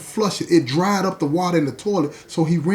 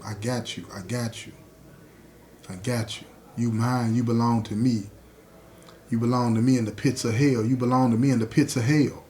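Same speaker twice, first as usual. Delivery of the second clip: lightly voiced voice from hell, lower than the teller; sinister voice; 5 semitones lower, 4 dB quieter than usual. A voice from the pits of hell claims a man, low and menacing. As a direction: claim the listener in a low voice, starting softly. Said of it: "hear a voice telling him".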